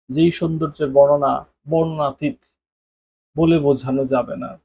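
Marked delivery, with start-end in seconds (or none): none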